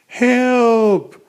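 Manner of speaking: high, thin call for help; feeble cry for help. Acted. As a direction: cry out faintly from the water, clinging on. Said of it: "A feeble voice was calling from the water".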